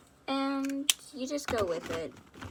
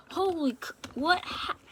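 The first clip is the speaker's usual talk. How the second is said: shocked; 7 semitones higher, 5 dB louder than usual. Acted. Shocked at injured friend.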